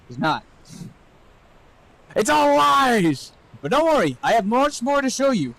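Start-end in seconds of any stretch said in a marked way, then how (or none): 2.2-3.3 high, slow hammed-up shout; mock dramatic shout. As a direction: bellow it like a grand confession.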